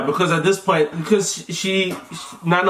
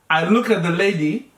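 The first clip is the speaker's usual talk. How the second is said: usual speaking voice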